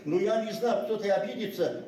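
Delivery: normal talking voice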